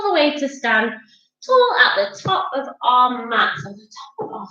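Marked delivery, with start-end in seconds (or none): none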